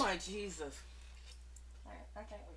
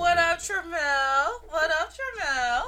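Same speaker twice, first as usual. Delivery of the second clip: drawn-out, loud, higher-pitched hail; calling out a greeting; 5 semitones higher, 4 dB louder, much slower than usual. Real, not acted. Host hails Tramel as he finally joins, calling out. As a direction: stretch out the greeting and sing it out to them. Greeting someone who just joined.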